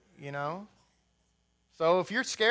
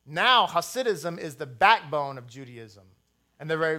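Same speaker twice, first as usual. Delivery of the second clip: normal talking voice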